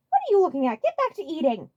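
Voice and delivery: high, loud irritated snap; glaring snap. Acted. Waluigi snapping at a staring cow, high and loud. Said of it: "Waluigi glared at her".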